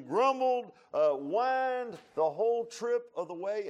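slow, loud, emphatic preaching; slow, loud sermon rising in force; fiery preaching. Acted. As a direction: pound each charge home like a pulpit sermon.

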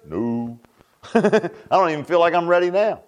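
ordinary talk, nothing marked

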